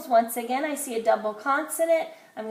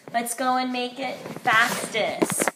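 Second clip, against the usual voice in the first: usual speaking voice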